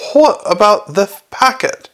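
high, exasperated outburst; shouted. A narrator's reading. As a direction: blurt it out, climbing in pitch. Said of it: "shouted Downey".

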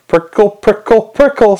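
high, needling little chant; teasing high-pitched prompt from a hidden speaker; insinuating under-table voice. A narrator's reading. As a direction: pitch it up and jab each repeat. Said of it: "said the voice".

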